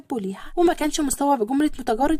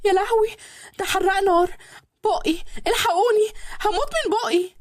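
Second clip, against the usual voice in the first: burnt mouth, crying out for help; pained cry; 7 semitones higher than usual. A narrator's reading. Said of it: "صرخت لما أكلت صنف معين". (صريخ عالي من الحرقان)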